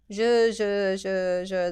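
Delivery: short clipped je syllables mimicking the machine; vocal demo of tattoo strokes; rhythmic je sounds. Shown as a demonstration. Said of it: "this direction".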